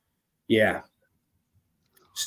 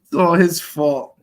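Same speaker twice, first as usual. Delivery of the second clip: put-on slow, higher mumbling old-man line; 7 semitones higher, 6 dB louder than usual. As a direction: drawl it like a tired president.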